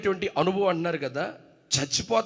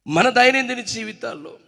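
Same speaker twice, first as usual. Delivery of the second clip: loud, higher rhetorical questions; full-force preaching; 8 semitones higher, 10 dB louder than usual. Acted. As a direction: press the mothers with each question. Preaching to mothers in congregation. (తల్లులతో బిగ్గరగా ఉద్రేకంగా ప్రసంగం)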